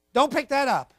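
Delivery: lightly acted stern parent; stern father. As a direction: snap it out like an annoyed dad. Voicing the father's command.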